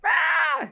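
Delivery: a short loud mock cry of a child; a playful fake howl of a crying kid; mock wail. Mimics a crying kindergartner.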